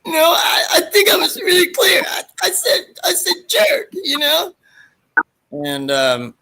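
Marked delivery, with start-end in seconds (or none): 0.0-4.5 very high, whiny, tearful protest; Bobcat character voice. Retold or imitated. Bobcat explaining himself to the girl, very high whiny protest. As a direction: plead it like a crying nerd. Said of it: "Bobcat said".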